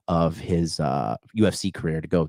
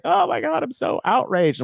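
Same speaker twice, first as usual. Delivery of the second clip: mock outrage. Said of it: "be like".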